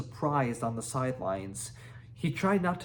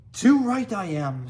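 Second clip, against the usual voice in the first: high, loud grumpy boast; small dragon grumpily boasting; 6 semitones higher, 8 dB louder than usual. A narrator's reading. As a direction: huff it out, offended. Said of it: "said Toothless grumpily".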